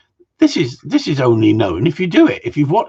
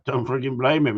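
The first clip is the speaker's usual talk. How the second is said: ordinary talk, nothing marked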